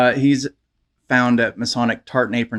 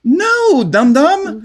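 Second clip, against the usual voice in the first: scolding; 11 semitones higher, 4 dB louder, much slower than usual. Retold or imitated. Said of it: "I'm like".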